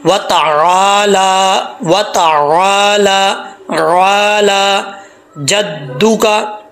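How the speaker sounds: ताला with the ला held one alif long, very slow; stretched lam. Shown as a demonstration. Teacher demonstrates the standing zabar, very slowly. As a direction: draw out ताला slowly, then जद्दुका. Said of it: "लंबा करके एक अलिफ की मिकदार".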